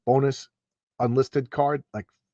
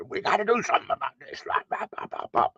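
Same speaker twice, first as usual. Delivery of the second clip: mock general; 8 semitones higher, 6 dB quieter than usual. Generals in a comic scene.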